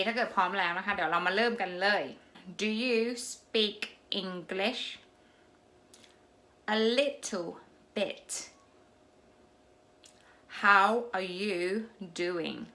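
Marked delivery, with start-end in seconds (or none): 2.6-4.8 slow, word-by-word English question. Shown as a demonstration. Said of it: "รอบที่สอง[name]จะพูดแบบช้าๆ". 6.8-8.3 slow answer with a gap before "bit". Shown as a demonstration. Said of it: "รอบที่สอง[name]จะพูดแบบช้าๆ". 10.6-12.7 slow, drawn-out listening-drill question. Shown as a demonstration. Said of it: "รอบที่สอง[name]จะพูดแบบช้าๆ".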